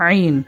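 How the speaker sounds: আইন begun inside the throat; আইন squeezed from the throat; throaty Ain. Shown as a demonstration. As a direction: demonstrate আইন as the Arabic pharyngeal letter. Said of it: "গলার ভিতর থেকে".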